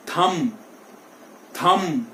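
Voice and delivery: "thumb" ending on m, b silent. Shown as a demonstration. Teacher models thumb for students, b silent. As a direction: close "thumb" on a plain m sound. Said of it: "बी को हम".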